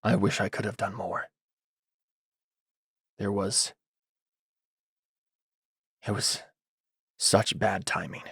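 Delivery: hushed, halting and regretful character voice; quiet, downcast confession; Mikhail. Acted. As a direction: speak it low and soft, slumped, with long pauses. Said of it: "he says in Mikhail's voice".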